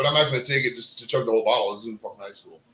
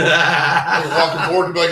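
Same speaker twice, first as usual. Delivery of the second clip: ordinary talk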